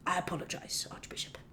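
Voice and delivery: very soft, contrite apology; hushed, humbled reply; apologetic bishop. Acted. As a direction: back down quietly before a superior. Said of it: "And he's like".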